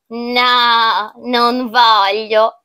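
slow, drawn-out, understated no; unruffled refusal. Acted. Child declining the pumpkin soup, slow measured refusal. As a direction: stretch the refusal out without raising the voice.

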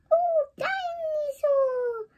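very high, slow little piglet wail; high, slow, wailing small boy; George voice. Acted. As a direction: whimper it like a tiny sad toddler.

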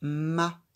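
"Ma." said loud and crisp as one joined sound; loud teacher demo of "Ma."; blending a syllable. Shown as a demonstration. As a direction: blend "Ma." clearly for a beginner reader. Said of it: "Et ensemble".

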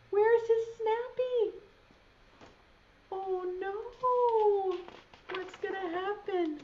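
high, rising worried calls; alarmed. Acted. Newton searching for lost Snappy, alarmed. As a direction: panic as Snappy goes missing.

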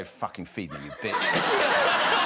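retold mum's rude cat voice, cracking up at the end. Mum voicing the family cat demanding food, rude cat voice. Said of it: "started doing this cat voice".

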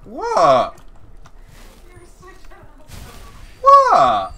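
loud high disbelieving cry; shocked. Real, not acted. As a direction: jolt up in disbelief. Startled in fight.

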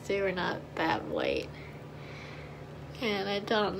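speaking with lip held aside; talking around fingers; finger pulling lip. Real, not acted. Seen 1: Finger pulls lip aside to show teeth throughout; brief release near 10.8.

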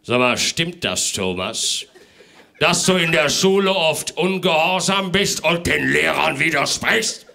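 loud, scolding Father Christmas; a strict Santa telling off the parents; stern Santa. Acted. Santa questioning child.

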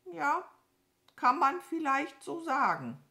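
ordinary delivery

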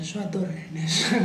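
soft laugh through the words. Real, not acted. Teacher amused by an example sentence, laughing. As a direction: say it softly, breaking into a laugh.

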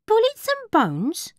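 high, doubtful barmaid voice; a high questioning barmaid asking back. A narrator's reading. Barmaid repeats name, doubtful.

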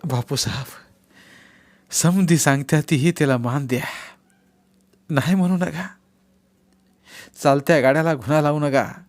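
a hint of a very old villager begging; old man begging Bapu to sign; old man's plea. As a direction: plead humbly, head bowed. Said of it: "पायावर डोकं ठेवून म्हणाला".

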